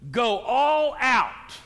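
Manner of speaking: ALL OUT loud, high and drawn out; emphatic exhortation. Real, not acted. Preacher drives home key command.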